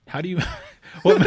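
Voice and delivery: high laugh breaking the question; laughing in disbelief. Real, not acted. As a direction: laugh at the absurd idea.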